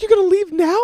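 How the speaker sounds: high, loud incredulous question; mock outrage. Girlfriend hears he is leaving, mock outraged screech. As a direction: shriek it as the indignant girlfriend.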